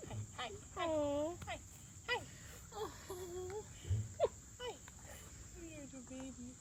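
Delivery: quiet cooing at a dog; whisper-soft greeting to the puppy. Real, not acted.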